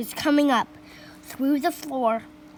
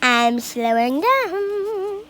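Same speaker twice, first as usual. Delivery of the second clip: put-on old grandma voice; 8 semitones higher, 4 dB louder than usual. A narrator's reading. Grandma demanding another dose from George, slowing down.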